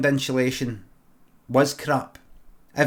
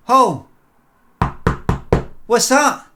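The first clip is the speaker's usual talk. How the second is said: much higher, louder shocked yelp; startled outcry; 9 semitones higher, 7 dB louder, slower than usual. Retold or imitated. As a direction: jump at a sudden noise. Neighbour startled in hall.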